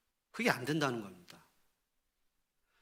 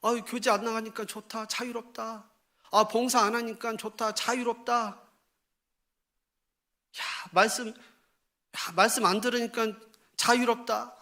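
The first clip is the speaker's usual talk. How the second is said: higher-pitched, pleased-with-oneself sighing; mock relief; 6 semitones higher than usual. Believer glad to skip fellowship and service, mock relief. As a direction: act out someone happily shirking duties.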